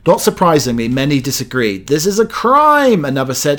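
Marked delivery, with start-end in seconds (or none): 1.9-3.0 high, slow, appalled cry; mock outrage. Host quoting an appalled commenter, high slow mock outrage. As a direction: wail it like a scandalised Brit.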